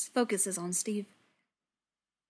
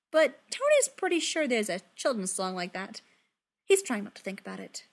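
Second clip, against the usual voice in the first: plain reading voice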